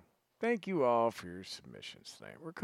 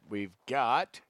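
normal talking voice

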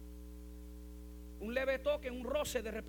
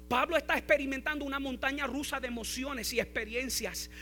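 loud, high, driving sermon delivery; full-force preaching; 5 semitones higher, 11 dB louder than usual. Acted. Pastor urging his congregation about Paul, preaching at full force.

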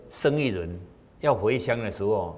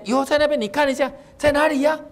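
high-pitched coaxing boatman; 13 semitones higher than usual. Acted. Boatman points into the river.

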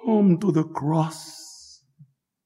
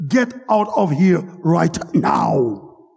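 king's mock-furious shout at the prisoner, higher and louder; feigned anger; 5 semitones higher, 7 dB louder than usual. King pretending to throw a prisoner out, mock furious shout. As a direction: thunder at him like a king pretending to rage. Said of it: "he acted as though he was angry".

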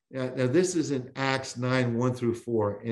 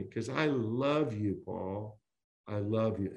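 tender voice of Jesus; 4 semitones lower, 6 dB quieter than usual. Acted. Jesus tenderly telling Saul he loves him, softly. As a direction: ease right down into a gentle whisper of love.

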